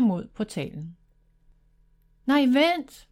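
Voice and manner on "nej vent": a sudden raised cry to stop her; exclaiming. A narrator's reading. Brother trying to stop his sister at the portal, exclaiming. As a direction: blurt it out to stop her going. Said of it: "udbrød Thomas".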